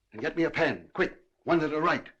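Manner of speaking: loud, commanding instructions; urgent orders. Acted. Judge sending servant for pen and telegraph, urgent orders.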